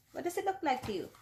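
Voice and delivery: ordinary delivery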